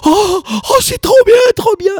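delighted high-pitched gush; very high excited voice. Retold or imitated. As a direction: squeal it, way up high. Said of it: "il me parle comme ça".

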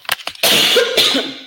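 real coughing from choking. Real, not acted. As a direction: cough to clear a choke. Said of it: "engasguei aqui".

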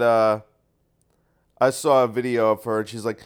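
ordinary talk, nothing marked

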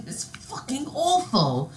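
high, drawn-out mock British chef; Gordon Ramsay. Co-host playing the TV chef, mock British disgust. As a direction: stretch it slowly in a fake British accent. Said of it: "Gordon Ramsay has a British accent".

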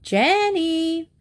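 high, sustained call upstairs. A narrator's reading. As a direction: sing out the name, held high. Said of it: "went to the front of the stairs and called".

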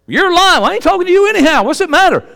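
high, loud, quick comeback; defiant retort. Acted. Preacher answers someone calling him a liar, defiant retort. Snaps back at an imagined accuser.

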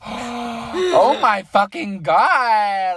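gasp, then a high, drawn-out shriek; shocked shriek. Retold or imitated. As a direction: stretch it out in disbelief. Own shocked reaction quoted, gasping.

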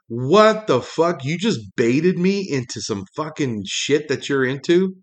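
usual speaking voice

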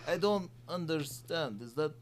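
put-on Andre Karolinko Russian accent. Asked by cohost, mock Russian baffled after dunk. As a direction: play a baffled Russian big man in a hammed-up accent. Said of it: "can you do an impression of Andre Karolinko".

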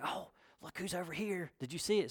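hushed, acted gossip of onlookers; giddy whispering. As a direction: murmur it excitedly behind a hand. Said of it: "hear all these whispers".

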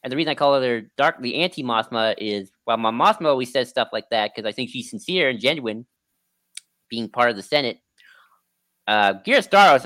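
ordinary delivery

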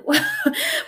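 high, bright little laugh. Real, not acted. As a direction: let a quick high laugh out. Recalling stuffy-nose yoga class.

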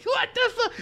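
very high squealed disbelief; freaked out. Retold or imitated. Student losing his mind over the nipple, high freak-out. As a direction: squeak in mock horror.

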